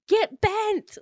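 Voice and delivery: high, loud retort; imitated indignant outburst; mock exasperated yell. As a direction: fling it out as an acted high-pitched insult. Retold bathroom quarrel.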